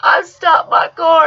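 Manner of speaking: normal talking voice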